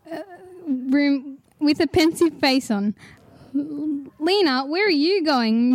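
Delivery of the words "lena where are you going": usual speaking voice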